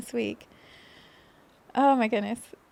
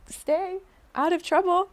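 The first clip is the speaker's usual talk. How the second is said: a high, lightly mocking stern warning, laughing round it; mock scolding inner voice; 7 semitones higher than usual. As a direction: poke fun at a strict inner parent. Mind scolding her, mocked.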